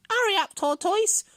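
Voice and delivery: high, loud jeering hare voice; calling-out hare. A narrator's reading. Hare taunts Tortoise over his shoulder, calling out high and loud. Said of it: "called out".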